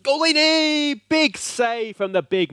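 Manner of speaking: high, loud cry of joy at the save; celebrating the big save; ecstatic shout. Real, not acted. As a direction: yell the keeper's name in triumph. Keeper saves crucial penalty.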